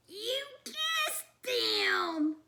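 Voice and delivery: high, slow astonished cry; can't believe it; amazed. Acted. Frightful and Hort astonished at their brother. Siblings amazed at Little Shock.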